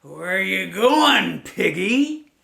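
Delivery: slow, loud, sly wolf voice; a wolf sizing up his dinner; loud drawn-out wolf. Acted. Wolf questions pig.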